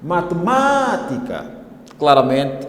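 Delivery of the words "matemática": slow Matemática, MA lifted and stretched; stress on MA in Matemática. Shown as a demonstration. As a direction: hold the second "ma" of Matemática high and long. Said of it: "a sílaba tônica é o segundo ma".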